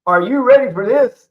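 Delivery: loud, high hype call; hype shout. Retold or imitated. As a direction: yell it like a hype man. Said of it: "like that type of thing".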